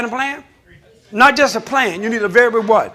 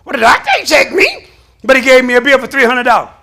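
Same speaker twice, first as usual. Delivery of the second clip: mock complaint; 3 semitones higher, 7 dB louder than usual. Mimics angry patient at doctor.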